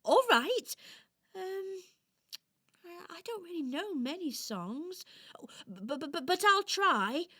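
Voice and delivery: high, stammering doll voice; hesitant little doll. Acted. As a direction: agree unsurely, tripping over the but. Doll agrees hesitantly to sing.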